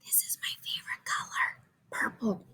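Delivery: hushed secret aside; whisper. Real, not acted. Confiding to young listeners.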